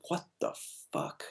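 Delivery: barely audible, mumble it in disgust; mumbling. Retold or imitated. Artist mumbling at his desk beside Jim, hushed mumble. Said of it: "mumbling to myself".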